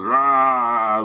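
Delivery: imitated monster roar, drawn out. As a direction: let out a mock triumphant roar. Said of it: "and goes".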